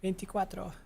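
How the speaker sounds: "Veinticuatro" said the Spanish way, softly. Pretend. Streamer saying a viewer's name number in Spanish, softly. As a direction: pronounce "Veinticuatro" as a Spanish speaker, lightly. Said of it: "maybe you have to say it in Spanish".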